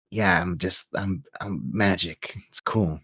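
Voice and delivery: low, soft, nonchalant quote; offhand movie hero. Retold or imitated. Hero explains surviving a gunshot, low and offhand. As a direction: shrug it off, lightly imitating the hero. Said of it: "And he's like".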